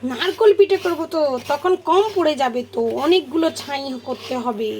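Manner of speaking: high, loud mock scolding grandmother; scolding grandma. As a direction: tell off a naughty child. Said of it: "ঠাম্মা বলে".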